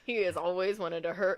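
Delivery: ordinary talk, nothing marked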